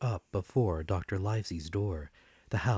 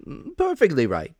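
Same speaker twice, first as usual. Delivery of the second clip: higher, louder, cutting in brightly; cheery interruption; 8 semitones higher, 9 dB louder than usual. A narrator's reading. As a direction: jump in with hearty cheer. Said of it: "he interrupted very cheerily".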